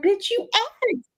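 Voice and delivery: sassy retort. Retold or imitated. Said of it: "And I'm like".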